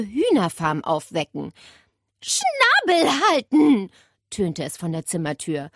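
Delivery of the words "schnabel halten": loud, high, drawn-out command; slow, imperious call from the doorway; stern scolding. A narrator's reading. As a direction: order the bird to shut up. Aunt scolds parrot from door.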